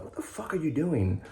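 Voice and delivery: high, hushed self-scolding; cussing at himself. Retold or imitated. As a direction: scold yourself under your breath, high. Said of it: "I started cussing at myself".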